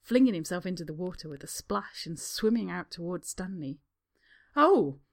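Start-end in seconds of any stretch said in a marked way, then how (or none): 4.5-5.0 slow, loud, stung sarcasm. Acted. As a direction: throw it back, hurt.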